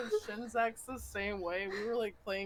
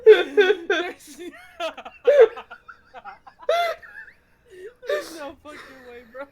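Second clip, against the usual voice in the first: a loud cry of disbelief through laughter; can't believe what she's reading; shocked laughing; 11 semitones higher, 12 dB louder than usual. Real, not acted. Reading her friend's text, shrieking with laughter.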